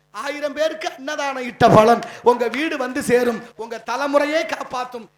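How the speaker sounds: booming, emphatic promise to the crowd; loud blessing. Real, not acted. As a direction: proclaim the reward at full volume. Preacher promises a blessing to listeners.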